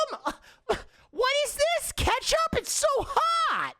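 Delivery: very high, hammed-up distress over mild food; mock spice panic. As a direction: pretend ketchup is burning your mouth, squeaky high. Parody of tasting spicy food.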